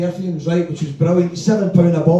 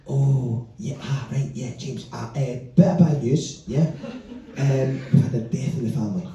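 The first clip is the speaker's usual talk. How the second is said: mumbling excuse; 5 semitones lower, 6 dB quieter than usual. Retold or imitated. Customer faking bad news.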